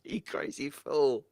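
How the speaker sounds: laughing fondly at a viewer, drawn out slowly. Real, not acted. Streamer laughing at a viewer's super chat, laughing. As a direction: chuckle the words out slow.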